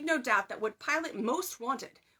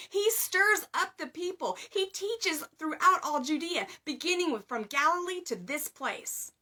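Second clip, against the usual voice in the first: spat accusations; 6 semitones higher, 4 dB louder than usual. A narrator's reading. Chief priests accusing the prisoner before Pilate, spitting angry. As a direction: spit the charges out at him. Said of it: "They spat out their accusations".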